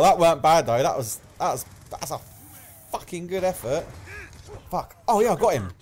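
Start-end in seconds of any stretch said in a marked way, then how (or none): none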